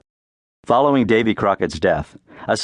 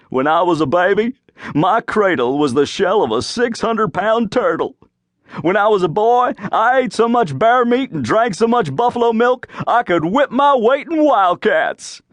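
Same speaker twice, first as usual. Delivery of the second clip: higher, swaggering tall-tale boast, building up; bragging Davy; 9 semitones higher than usual. A narrator's reading. Davy telling folks about his baby days, boastful. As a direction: puff up like a backwoods bragger.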